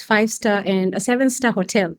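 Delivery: normal talking voice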